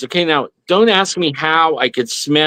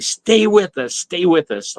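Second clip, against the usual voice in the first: retold doctor's yell, pitched up; imitated yelling; 4 semitones higher than usual. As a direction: urgently call them back, held down in volume. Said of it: "and he was yelling".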